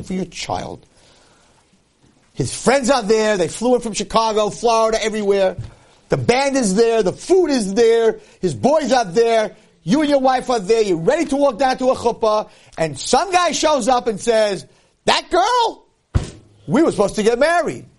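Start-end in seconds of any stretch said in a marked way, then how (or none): 2.3-14.6 building excitement. Real, not acted. Preacher paints the wedding scene. 15.0-17.8 indignant stranger. Acted. Stranger interrupts the wedding.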